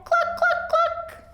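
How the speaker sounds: very high clucking hen voice. Acted. Teacher voicing Hen answering Blue, high clucks. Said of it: "and Hen said".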